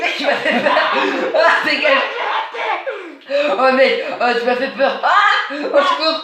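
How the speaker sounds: startled high yelps with nervous laughter; jump-scared gamer yelling for help. Real, not acted. Startled by player in hide-and-seek.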